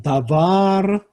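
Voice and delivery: "다바르" with 바르 stressed and stretched; final-syllable stress. Shown as a demonstration. Teacher demonstrating late Hebrew stress, "다바르" stressed on the last syllable. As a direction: lean into the end of "다바르". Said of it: "강세가 뒤에 오고요".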